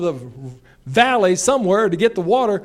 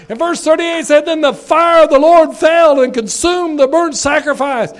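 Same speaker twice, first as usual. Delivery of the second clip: louder, higher revival preaching, driving hard; full-force preaching; 4 semitones higher, 7 dB louder than usual. Acted. Preacher rousing the congregation about revival, at full force. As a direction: preach it like a tent-revival evangelist.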